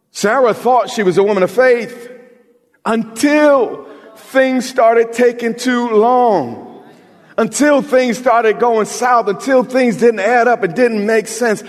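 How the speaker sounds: high, slow, hammering sermon lines; building fervent sermon cadence; full-force preaching. Acted. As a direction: lean into each until like a preacher.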